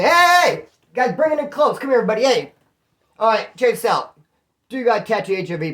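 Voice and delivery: high, loud hammed-up call to a crowd; hammed-up summoning of everybody; mock rallying call. To the people in the holding tank, hammed-up loud rallying call.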